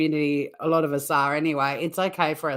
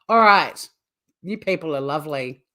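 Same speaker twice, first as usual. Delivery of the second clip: ordinary delivery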